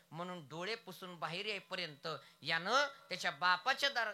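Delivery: ordinary delivery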